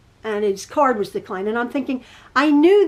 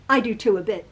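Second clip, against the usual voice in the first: ordinary delivery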